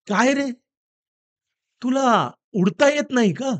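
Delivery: teasing question to the falling dog; parrot asking. Acted. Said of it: "हा पोपट त्याला विचारतो". (पोपटाचा उंच, हलका प्रश्नार्थक आवाज)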